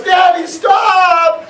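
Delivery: slow, loud, high shout of stop; child shouting. Retold or imitated. Son begs his father to stop the scary story, shouting. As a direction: yell it like a scared kid begging. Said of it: "my son goes".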